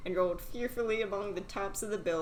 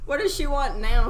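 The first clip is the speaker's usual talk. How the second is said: loud, higher exasperated fish; the fish groaning at another demand; 5 semitones higher, 9 dB louder than usual. Acted. Fish fed up with the fisherman's wife, loud. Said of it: "said the fish".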